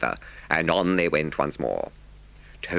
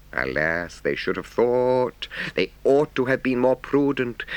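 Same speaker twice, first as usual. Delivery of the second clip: ordinary delivery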